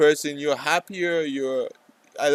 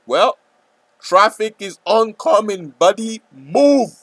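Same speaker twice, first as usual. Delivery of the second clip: loud urgent yell, pitched way up; shouting warning; 9 semitones higher, 9 dB louder, slower than usual. Acted. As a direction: shout at him to get out of the way. Warning a pedestrian of traffic.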